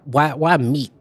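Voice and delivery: usual speaking voice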